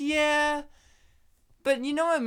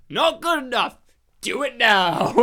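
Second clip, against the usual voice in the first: bossy boss voice; 4 semitones higher, 6 dB louder than usual. Retold or imitated. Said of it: "And he goes".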